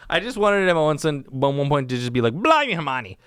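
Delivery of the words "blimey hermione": put-on rough go at a British accent; lightly attempted British accent. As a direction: mimic a British exclamation, not quite landing it. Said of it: "can't do the accent".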